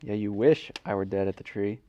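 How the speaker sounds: ordinary talk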